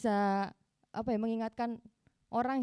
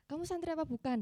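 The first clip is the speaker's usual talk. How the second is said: higher-pitched quoted question, mildly put on; mock challenge; 6 semitones higher than usual. Religious teacher questioning a student's identity, mock challenge. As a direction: imitate someone questioning her, voice lifted. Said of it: "gitu kan".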